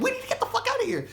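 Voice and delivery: very high, fast squeal; hammed-up high-pitched urgency; panicked echo. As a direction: throw it back in a frantic high voice. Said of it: "I'm like".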